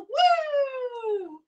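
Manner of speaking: high, slow gushing thanks; sing-song gratitude to the donor; thanking the donor in a high, drawn-out voice; excited thanks. Real, not acted.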